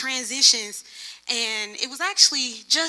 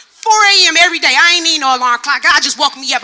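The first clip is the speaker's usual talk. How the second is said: loud, rising testimony; fervent preaching; 2 semitones higher, 10 dB louder than usual. Real, not acted. Preacher testifying to her congregation, loud and fervent. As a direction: preach it out to the church.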